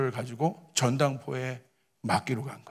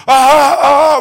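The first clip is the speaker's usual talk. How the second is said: loud, high imitated guffaws; mock belly laugh; 11 semitones higher, 16 dB louder, much slower than usual. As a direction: imitate senior pastors bursting out laughing. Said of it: "막 웃으시는 거예요". (크고 높게 흉내 낸 박장대소)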